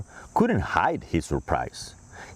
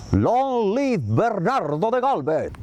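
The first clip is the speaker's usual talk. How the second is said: cheering; 11 semitones higher than usual. Acted. Senator cheering for Galvez, high and excited. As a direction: cheer him on with joy.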